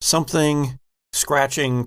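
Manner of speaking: ordinary talk, nothing marked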